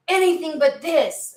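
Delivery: high, drawn-out mock plea; exasperated plea. Querent begging Spirit for any change, exasperated plea. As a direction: wail it lightly, slow and high.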